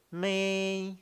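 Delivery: 咪 held high and level; first tone. Shown as a demonstration. Teacher modeling the first tone, 咪 long and high. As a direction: draw 咪 out on one high note. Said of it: "第一声是".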